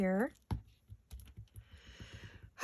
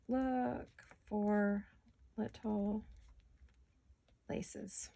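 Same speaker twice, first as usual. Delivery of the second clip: dictating slowly, fading out; 5 dB quieter, much slower than usual. Shown as a demonstration. Crafter dictating her shopping list, spacing the words and fading to a whisper. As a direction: Look for little laces spoken word by word as she writes, trailing to a whisper. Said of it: "I'm gonna say".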